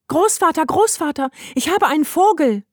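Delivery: put-on high, excited little girl; excited calling. A narrator's reading. Heidi storms in to tell grandfather, excited. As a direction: call out the big news, pitched right up. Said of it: "rief sie aufgeregt".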